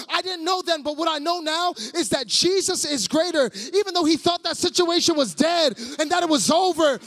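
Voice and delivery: loud, high, driving sermon climax; preaching at full force. Real, not acted. Preacher telling youth Jesus is greater, full-force preaching. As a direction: proclaim it to the room at full voice.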